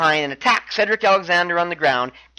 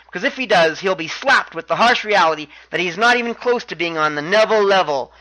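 ordinary talk, nothing marked

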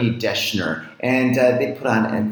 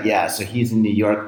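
ordinary talk

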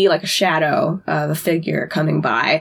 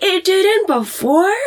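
much higher, slow disbelieving question; mock incredulous; 12 semitones higher, slower than usual. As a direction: sound stunned he'd say that. Bobby incredulous at Dean.

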